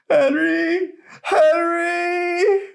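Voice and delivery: very high, loud crying call. A narrator's reading. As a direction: call for her husband to rescue her. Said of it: "she called out".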